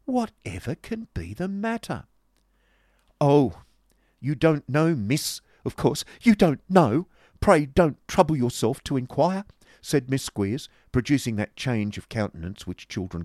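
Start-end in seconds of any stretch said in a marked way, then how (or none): 0.0-2.1 high-pitched innocent wondering; put-on innocent surprise; feigned amazement. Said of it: "as if in fresh amazement". 3.2-9.5 loud, high-pitched huffy retort; stung, scornful outburst; indignant sarcasm. Acted. Acted quarrel, Miss Squeers retorting.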